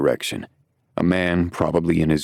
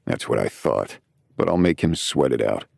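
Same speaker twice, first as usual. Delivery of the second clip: plain reading voice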